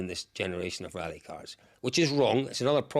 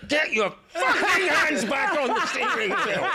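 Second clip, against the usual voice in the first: high, furious panicked shout; retold shrieking; 4 dB louder, slower than usual. Passenger orders driver to grip wheel, mock shrieking. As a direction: scream the order at the driver, hammed-up. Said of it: "and I'm shrieking".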